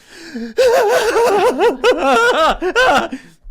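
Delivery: long, loud, high cackling laugh; unhinged cackle at the mirror; manic cackle. Retold or imitated. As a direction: cackle madly at your own reflection. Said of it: "just goes".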